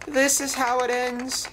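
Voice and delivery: high-pitched, doomed little lament; sad resignation. A narrator's reading. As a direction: lament your end in a high character voice. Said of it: "he mumbled sadly".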